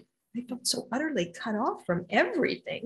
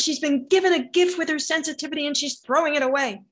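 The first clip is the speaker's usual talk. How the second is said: raised, louder scolding of the husband; retold yelling; 6 semitones higher, 7 dB louder than usual. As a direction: imitate her yelling at him. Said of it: "she yells at my husband".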